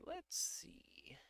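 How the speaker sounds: breathy whispered aside; a private whispered let's see. Real, not acted. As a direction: whisper it to yourself. Whispering to himself mid-search.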